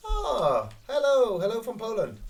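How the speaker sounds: high, loud bright greeting; cheery hello. Real, not acted. Greeting Polish viewer.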